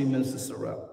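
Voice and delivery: "Minister Sorrell" low and soft; "Sorrell" said low and quiet, almost to yourself; name said playfully. Shown as a demonstration. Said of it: "saying your name three different ways".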